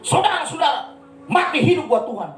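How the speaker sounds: high-pitched, shouted pulpit proclamation; mock preacher. Other pastors exhorting the congregation, in a mock preaching shout. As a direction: imitate a preacher rallying the congregation. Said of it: "kalau ada pendeta-pendeta khotbah gini".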